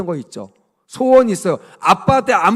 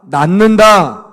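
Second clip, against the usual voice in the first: one word, loud and drawn out slowly; loud call to repeat; 10 dB louder, much slower than usual. Shown as a demonstration. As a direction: proclaim it big and slow. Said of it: "따라합시다".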